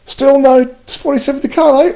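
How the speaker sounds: very high-pitched squeal of frustration; high, comic wail of annoyance; mock-exasperated. Real, not acted. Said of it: "this is starting to pee me off".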